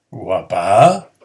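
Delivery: puzzled, questioning tone; teacher demoing doubt; doubtful question. Shown as a demonstration. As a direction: sound unsure it is true. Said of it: "extrañarse o, como mínimo, ponerlo en duda".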